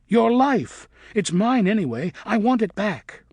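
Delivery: snatch at what he won't use; fierce demand. A narrator's reading. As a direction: bat demanding his life in rage. Luna attacking Griffin.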